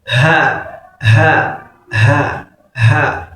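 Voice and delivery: "Ha" with airy h, no nasal buzz; correct ha pronunciation. Shown as a demonstration. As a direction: push breath out on each "Ha", nose not vibrating. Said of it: "If you want to pronounce ha".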